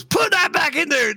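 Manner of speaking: put-on high, yelled stab at an Irish accent; bad Irish accent. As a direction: holler it in a botched Irish accent. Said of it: "I can't do an Irish accent".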